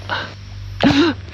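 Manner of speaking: sudden high unvoiced gasp of fright; a quick frightened intake of breath; sharp startled gasp. Real, not acted. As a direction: catch your breath in real shock. Startled by sound in dark.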